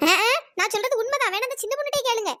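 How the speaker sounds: ordinary delivery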